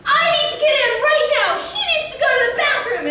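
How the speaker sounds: hammed-up furious mom at the gate; imitated screaming mom. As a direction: shriek it like a frantic mother. Mother demanding entry.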